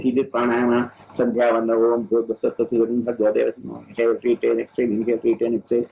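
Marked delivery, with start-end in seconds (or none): none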